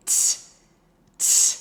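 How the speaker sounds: "T" as a sharp, loud t burst held at the ridge; loud demo t bursts; frozen-tongue t. Shown as a demonstration. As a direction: hit "T" hard and hold the tongue still. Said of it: "So like this, ready?".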